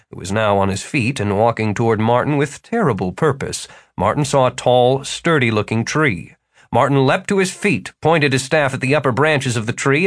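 plain reading voice

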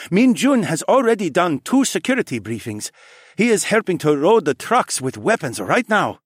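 high, bright brother voice; eager younger brother. A narrator's reading. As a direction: brother filling him in. Sunhee character voice.

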